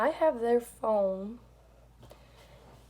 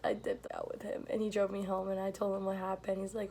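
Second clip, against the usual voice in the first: ordinary talk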